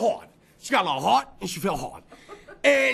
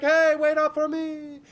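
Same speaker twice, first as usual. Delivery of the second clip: calling from behind; 4 semitones higher, slower than usual. Retold or imitated. Friend left behind as he heads for the Lyft, calling out. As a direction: imitate a straggler's drawn-out, higher call. Straggler calls after him in story.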